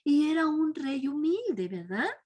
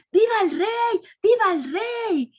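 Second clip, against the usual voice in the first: higher, louder cheer of a crowd; 6 semitones higher, 5 dB louder than usual. Retold or imitated. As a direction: cheer for the arriving king. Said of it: "cuando gritaban esto". (la multitud vitorea al rey, gritando)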